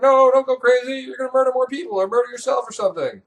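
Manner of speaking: hammed-up crowd begging him to stop; mock alarmed pleading. Everyone begging Orestes not to go crazy, mock alarm. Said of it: "everyone's like".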